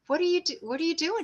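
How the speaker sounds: retold disbelief, pitched well up; baffled question. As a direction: ask it high, imitating your own bewilderment. Retelling her baffled reaction to spraying.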